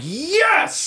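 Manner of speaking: high ecstatic shout of relief; blissed-out yell; ecstatic yes. Retold or imitated. High junkie quote.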